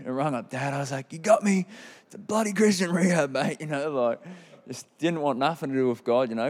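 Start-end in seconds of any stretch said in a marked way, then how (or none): 1.1-3.6 loud, high mock outrage at Dad; mock indignant. Complaining to Dad on the phone about the rehab, loud mock outrage. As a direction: play the tricked, outraged son.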